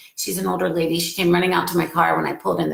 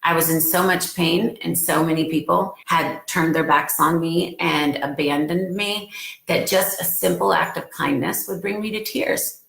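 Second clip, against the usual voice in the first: ordinary talk, nothing marked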